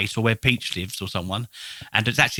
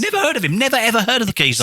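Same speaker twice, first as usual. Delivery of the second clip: mock indignant; 8 semitones higher than usual.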